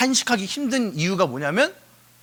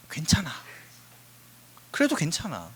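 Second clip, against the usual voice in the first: reassuring; 3 semitones lower, 6 dB quieter than usual. Real, not acted. Gentle reassurance to congregation.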